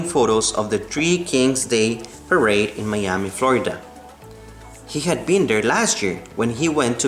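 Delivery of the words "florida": ordinary delivery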